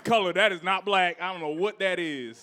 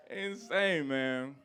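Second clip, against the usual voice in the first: ordinary delivery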